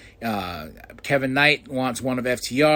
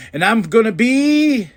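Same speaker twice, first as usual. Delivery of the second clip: imitating Toni; 7 semitones higher, 6 dB louder than usual. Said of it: "she goes".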